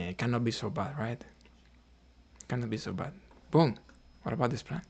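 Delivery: usual speaking voice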